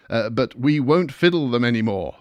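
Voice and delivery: ordinary delivery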